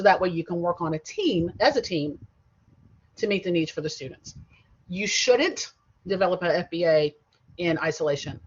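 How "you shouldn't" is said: SHOULDN'T leaned on, louder than the rest; stress on SHOULDN'T. Real, not acted. Teacher warning class about working alone, stress on SHOULDN'T. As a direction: punch the word shouldn't firmly. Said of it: "I stress the word shouldn't".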